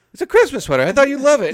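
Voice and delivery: high, loud insistence; loud mock protest. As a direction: imitate a brother protesting loudly. Brother protests in acted quarrel.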